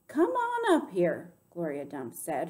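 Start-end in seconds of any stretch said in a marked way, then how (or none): 0.1-1.3 firm, raised, slow command; high, stern storybook call; hard and serious. A narrator's reading. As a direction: order them up, loud and drawn out. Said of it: "her voice all hard and serious".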